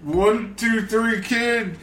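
put-on high, loud slurred drunk rambling; drunk wrestler impression. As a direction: stagger through it like a drunk. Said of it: "stumbling around and throwing together these completely incoherent sentences".

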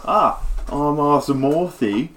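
laid-back bard introducing himself, cocky; rock star bard. Acted. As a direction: swagger it like a washed-up rock star. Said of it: "and he's like".

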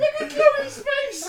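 high, slow, laughing squeal. Real, not acted. Mocking a co-host's face, a high, slow, laughing squeal. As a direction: squeal it out through the giggles.

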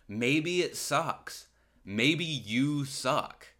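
slow, pointed and deliberate; slow emphasis. Real, not acted. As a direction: drag it out to land the jab. Pointed jab at employers.

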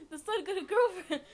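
loud, high retelling through laughter; laughing mock announcement. Storyteller redoing the mom's big news, laughing. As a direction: crack up while acting the excited parent. Retold mom, teller cracking up.

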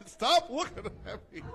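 high, loud laughing plea. Real, not acted. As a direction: say it through laughter. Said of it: "I can't fucking stop laughing".

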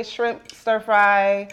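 normal talking voice

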